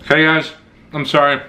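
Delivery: chewing while talking; talking between bites; mouth full of bread. Real, not acted. Seen 1: Bites bread at -1; lips close between words, chewing-like, until 1.3.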